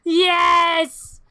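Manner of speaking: loud, slow villain gloat with a cackle; gremlin taunt. Acted. Gremlin in the pool threatening Billy, loud gloating. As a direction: gloat over the child from the pool.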